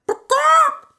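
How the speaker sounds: very high, loud squawk; chicken cry. A narrator's reading. Chicken squawking at the frog, very high and loud. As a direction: squawk like a hen. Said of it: "she cried".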